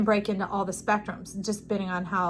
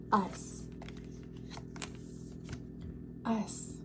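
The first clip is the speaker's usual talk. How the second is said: much softer, lingering repeat; soft echo; 13 dB quieter than usual. Real, not acted. Reader echoing the spirit's word softly. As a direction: let the word fade away. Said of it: "repeats the message softly".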